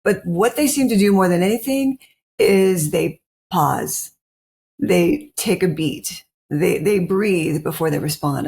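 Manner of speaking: slow, measured pauses, lightly voiced; calm slow telling. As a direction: lay it out slowly, beat by beat. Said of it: "And he goes".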